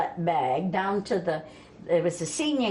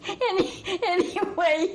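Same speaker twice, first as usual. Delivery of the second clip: laughing; 12 semitones higher, slower than usual. Real, not acted. Still laughing after the punchline.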